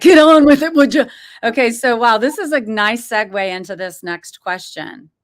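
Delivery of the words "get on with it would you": high, loud impatient "get on with it"; impatient mock shout. Host voicing the guest's impatience with a mock shout. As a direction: hurry him up with a mock shout. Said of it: "it's like".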